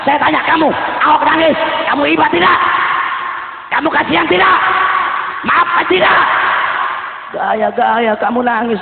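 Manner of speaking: shouted, high, rousing sermon cadence; rally the crowd with every line; fiery preacher at full voice; preaching at full force. Acted. Sermon against Ahok.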